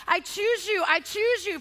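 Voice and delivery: insistent, punched vows; emphatic declaration. Acted. Repeats the emphatic pledge.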